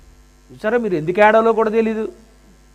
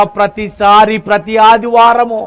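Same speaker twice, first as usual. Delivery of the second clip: ordinary talk, nothing marked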